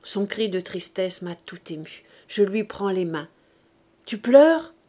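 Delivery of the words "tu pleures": sudden, louder, higher question; startled concern. Acted. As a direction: catch her crying and blurt it. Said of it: "n'a pu retenir un sanglot".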